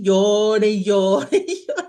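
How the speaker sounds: laughing through the retelling; rozbawione wspomnienie płaczu. Retold or imitated. Laughs recalling her own crying.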